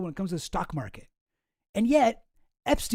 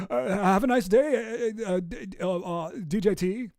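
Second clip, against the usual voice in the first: put-on lightly done South African Musk accent with stammered uhs; hesitant, half-there South African Musk voice; Elon Musk impression; 3 semitones higher than usual. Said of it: "seventy percent there to a decent Elon".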